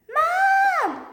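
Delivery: loud, high, held shout; shouting for Mom. Acted. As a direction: scream to get your mother's attention. Child yells for mother again.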